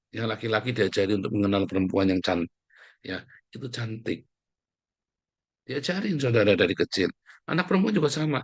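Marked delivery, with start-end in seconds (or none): 3.0-4.2 put-on soft coaxing to child. Parent teaching child again.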